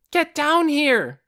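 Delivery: high, loud, slow yell; shouting. Acted. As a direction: yell him down from the stands. Aura yelling at her brother.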